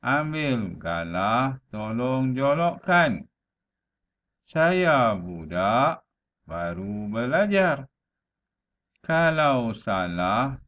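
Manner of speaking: slow, lilting verse with stretched vowels; unhurried teacher's model of a rhyme; slow pantun recitation. Shown as a demonstration. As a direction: recite slowly, phrase by phrase, with small pauses. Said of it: "perlahan-lahan".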